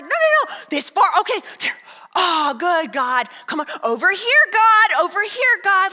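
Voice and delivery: hammed-up shouting at God; mock bossy calling. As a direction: call out to God like a curling skip. Directing God like curling stones.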